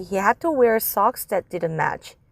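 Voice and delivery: plain reading voice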